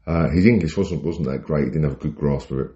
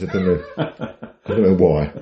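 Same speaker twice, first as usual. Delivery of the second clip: laughing; 4 semitones higher, 4 dB louder, slower than usual. Real, not acted. Laughing at own itching.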